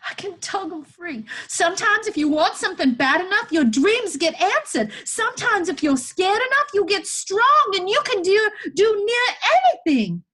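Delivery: put-on very high, loud, frantic boy; desperate, insistent boy. Boy tugging at chains to free woman, desperate. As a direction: cry it out high and loud, willing it true.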